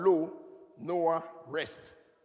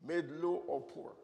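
much softer repeat; quiet echo; 4 semitones lower, 8 dB quieter than usual. Shown as a demonstration. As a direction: murmur it back. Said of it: "And Lamech means".

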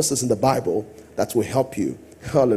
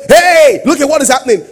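mock alarmed cry; 10 semitones higher, 9 dB louder than usual.

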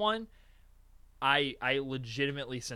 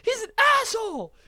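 high-pitched, loud outburst; mock angry shout; 5 dB louder than usual. Retelling the fight over the song, mock angry shout. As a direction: yell it in hammed-up outrage.

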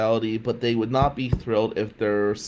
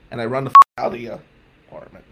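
normal talking voice

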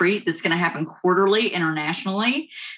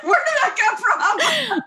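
high, slow, laughing question; high-pitched giggly where did that come from; laughing disbelief. Retold or imitated. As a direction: squeak it out in amused disbelief. Reacting to husband's Boston Ma.

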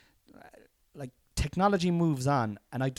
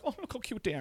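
doting coo; 8 semitones higher, 9 dB quieter, faster than usual. Retold or imitated. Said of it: "I'd be like".

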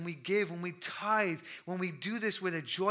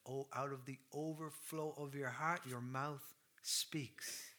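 hushed scripture quote; 3 semitones lower, 8 dB quieter, slower than usual. Real, not acted. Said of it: "What does the Lord Jesus say himself".

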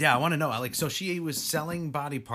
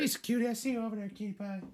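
high-pitched pet voice to her sleeping dog; dog-talk voice; 12 semitones higher than usual. Real, not acted. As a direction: coo at the puppy way up high. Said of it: "turn into that high-pitched voice".

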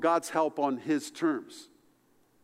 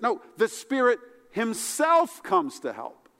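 the Spirit himself pushed higher and louder; emphatic; 8 semitones higher, 5 dB louder than usual. Retold or imitated. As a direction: hit himself hard. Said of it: "made any more emphatic".

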